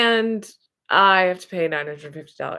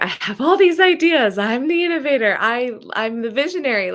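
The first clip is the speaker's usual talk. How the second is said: higher, louder, gushing self-pitch; excited pitch; 7 semitones higher, 4 dB louder than usual. Retold or imitated. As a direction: sell your big dreams with rising excitement. Pitching herself to partner.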